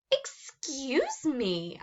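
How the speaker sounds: slow, drawn-out courteous opener; overly polite. A narrator's reading. Narrator addressing the mucus monster, slow and overpolite. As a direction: stretch it out with mock politeness.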